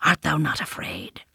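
soft unvoiced question; whisper. A narrator's reading. Panther asking Mowgli about fear, whispered. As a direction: whisper it close to the boy.

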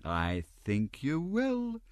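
high, slow, sly insistence; knowing Mrs Mann. A narrator's reading. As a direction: tease him, sure he'll give in.

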